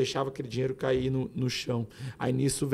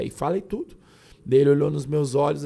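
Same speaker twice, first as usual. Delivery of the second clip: ordinary talk, nothing marked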